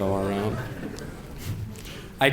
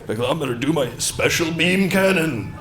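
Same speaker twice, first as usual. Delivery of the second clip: Piccolo. Retold or imitated. Piccolo voiced in bit.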